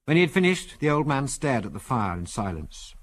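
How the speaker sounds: ordinary delivery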